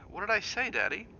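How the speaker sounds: a lightly voiced young boy, puzzled; small boy Danny. Boy's voice again.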